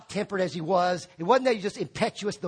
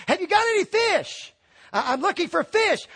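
higher, louder call across water; 9 semitones higher, 3 dB louder than usual. Retold or imitated. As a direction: call out to the boat from the shore. Customer calling to boats.

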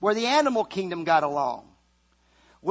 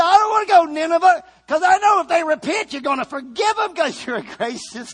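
shouted fast, much higher and louder; Jonah's angry complaint; 9 semitones higher, 6 dB louder, faster than usual. Retold or imitated. Jonah protesting to God about Nineveh, fast furious shout. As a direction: blurt it out like a furious, sulking prophet.

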